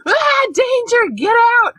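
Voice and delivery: mock panic. Podcaster imagining Harry panicking at the elf, hammed-up. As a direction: yelp it like a startled kid. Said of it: "Instead of, like".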